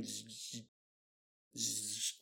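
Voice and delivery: very quiet, low buzzing noise; hushed imitation of electric crackle; electrical buzz. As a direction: imitate a flickering light with a soft buzz. Imitates flickering lights.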